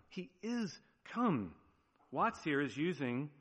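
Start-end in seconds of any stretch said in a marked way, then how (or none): 0.1-1.5 slow, high emphasis on "is"; stress on IS. Shown as a demonstration. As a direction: draw out "is", then land on "come". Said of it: "not that the Lord has come, but is come".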